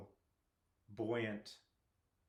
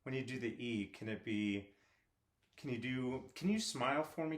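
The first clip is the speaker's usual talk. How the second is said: ordinary talk, nothing marked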